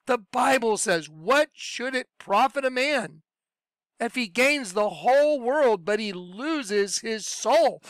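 fervent sermon delivery; preaching. Acted. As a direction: press the call to turn back to God. Preaching a call to repent.

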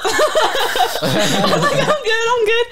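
high laughing quote. Retold or imitated. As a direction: laugh through the words. Laughing while quoting herself.

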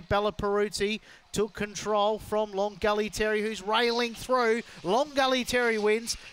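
high, loud climax of the race call; excited finish call. Real, not acted. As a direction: lift hard to the winner.

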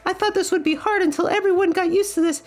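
very high, squeaky cartoon voice; cheery high-pitched character. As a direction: chirp it happily in a tiny high voice. Plays a game character.

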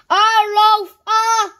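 slow, high, loud shout cut off; top-of-the-voice shout. A narrator's reading. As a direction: bellow each word, then stop dead. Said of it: "shouting at the very top of his voice".

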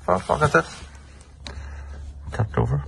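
sudden high-pitched oh, surprised; a real little gasp of surprise at the find; high surprised yelp at the toppled trophy. Real, not acted. Finds a trophy knocked over.

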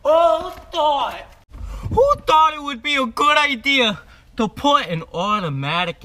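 high, loud mock-outraged Jersey rant; exasperated shouting in a Jersey accent; angry Jersey mobster. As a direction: yell it like an angry Italian-American mobster dad. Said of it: "my terrible Jersey Italian mobster, angry Wolf of Wall Street dad accent".

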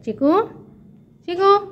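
high, rising name call; calling a pet. Real, not acted. Calling Chiku to look round, high rising call. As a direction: call the pet to get its attention.

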